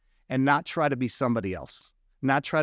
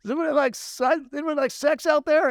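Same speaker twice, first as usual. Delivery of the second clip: stage pitch; 11 semitones higher than usual. Retold or imitated. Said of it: "I'm like".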